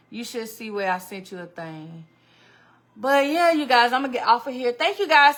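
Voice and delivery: normal talking voice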